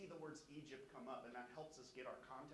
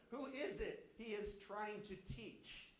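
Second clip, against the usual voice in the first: slow proud scoff; 6 semitones higher, 4 dB louder, slower than usual. Acted. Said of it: "Do you hear their pride?".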